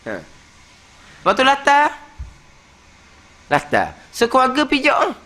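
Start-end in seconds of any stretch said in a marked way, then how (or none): none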